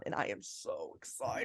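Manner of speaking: soft and low, as if too excited to say it aloud; excited whisper. Real, not acted. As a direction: drop to a thrilled whisper. Whispered excitement after a long build-up.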